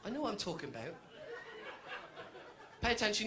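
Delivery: normal talking voice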